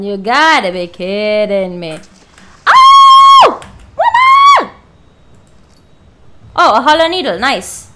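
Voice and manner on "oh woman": shrieked, much higher and louder; jump-scare shriek at the woman; startled scream. Real, not acted. Terrified shriek.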